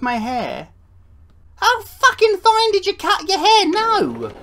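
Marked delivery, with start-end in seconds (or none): 1.5-4.3 much higher, louder disbelieving yell; horrified shout. Real, not acted. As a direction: scream it in shock. Horrified at visitor's exposed brain.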